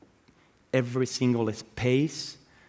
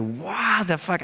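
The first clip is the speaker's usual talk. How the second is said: amazed reaction; 7 semitones higher, slower than usual. Retold or imitated. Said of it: "And it's like".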